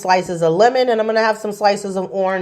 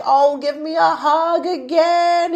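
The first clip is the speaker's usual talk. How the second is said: excited sing-song; 8 semitones higher than usual. Retold or imitated. Client's excited reply retold.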